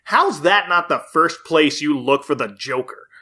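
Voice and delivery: normal talking voice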